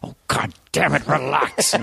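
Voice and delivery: exasperated outburst after hushed soothing; temper snapping. Acted. Horse whisperer losing his temper, exasperated. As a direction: lose patience with the horse. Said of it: "a horse whisperer with a temper".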